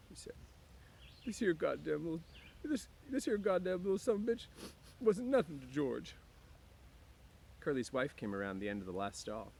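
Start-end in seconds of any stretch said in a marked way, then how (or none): 1.3-6.2 much higher, bitter curse; high Lenny, petulant anger; Lenny cursing. A narrator's reading. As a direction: dismiss the pup to cope.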